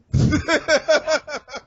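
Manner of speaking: loud high burst of laughter. Real, not acted. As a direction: crack up out loud. Laughing at team name.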